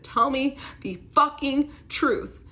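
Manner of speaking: high, loud, slow furious demand; retold scream. To her boyfriend caught gambling, a loud, high, slow yell. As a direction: scream it into his face, slowly spitting out each word. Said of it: "I scream in his face".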